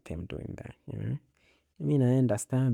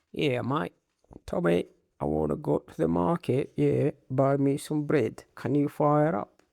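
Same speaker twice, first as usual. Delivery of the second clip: higher-pitched mock English accent; 5 semitones higher, 4 dB louder than usual. Newly rich man ordering his pilot, mock English accent. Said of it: "start having English accent".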